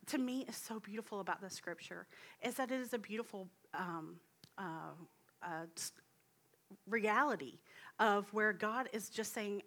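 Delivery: normal talking voice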